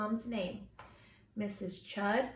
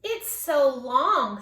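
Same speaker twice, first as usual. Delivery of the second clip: slow mocking; 7 dB louder, much slower than usual. Classmate mocking her name.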